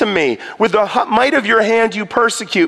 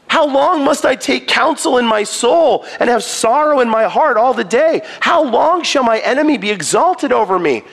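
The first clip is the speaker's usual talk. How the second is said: lamenting psalm read with higher, rising pitch; dramatic scripture reading; 5 semitones higher than usual. Acted. Preacher voicing David's lament to God, anguished.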